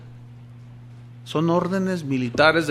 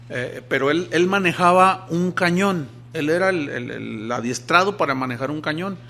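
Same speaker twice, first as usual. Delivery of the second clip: ordinary talk, nothing marked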